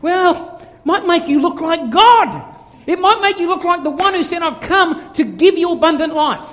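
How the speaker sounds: high, loud sermon at full force; impassioned preaching. Real, not acted. Preacher telling of God's joy and enthusiasm, impassioned preaching. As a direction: drive it home with fervour.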